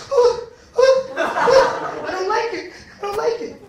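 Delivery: very high, loud, laughing panic; high panicked squeal. Retold or imitated. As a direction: squeal it in mock terror, laughing. Surrounded by the crowd, scared.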